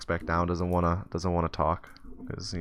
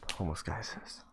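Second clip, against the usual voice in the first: usual speaking voice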